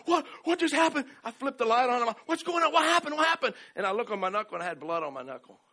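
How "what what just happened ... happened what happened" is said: alarmed confusion. Retold or imitated. Waking in alarm after punch.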